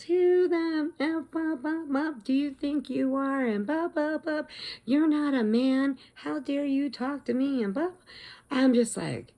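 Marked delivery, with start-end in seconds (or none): 0.0-3.4 high, ranting imitation of an irate man; retold angry swearing. Irate man swearing at lady in line, mock rant. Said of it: "he's swearing at this lady". 3.4-7.9 high-pitched imitation of a man's tirade; mock berserk rant. Berserk man berating retired nurse, mock indignant rant. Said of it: "he just starts going berserk".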